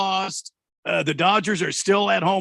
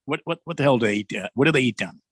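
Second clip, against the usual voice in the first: ordinary talk, nothing marked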